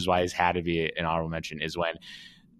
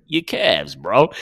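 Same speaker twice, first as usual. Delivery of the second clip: Drama's delivery quoted; 3 semitones higher, 9 dB louder, slower than usual. Retold or imitated. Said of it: "I just love the delivery of".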